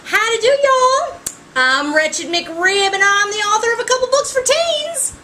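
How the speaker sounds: exaggerated Southern accent introducing herself to readers; best Southern accent. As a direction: put on a big, folksy Southern accent for a joke intro. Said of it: "do it in my best Southern accent".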